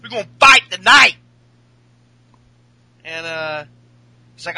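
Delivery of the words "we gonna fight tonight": fired-up mock wrestler voice, much higher; hammed-up imitation of a wrestler taking a challenge; Jey Uso. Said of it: "And Jay's like".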